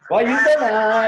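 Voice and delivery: hammed-up girl asking why you smile; mimicking the girl. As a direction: stretch it out like an exaggerated imitation of her. Mimicking the girl's question.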